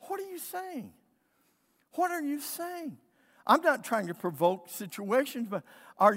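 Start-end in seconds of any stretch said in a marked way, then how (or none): 0.1-3.0 high, hushed disbelief; retold soft, high-voiced incredulity; incredulous reply. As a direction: question it softly, pitched up in bafflement. Acted baffled reply to pastors.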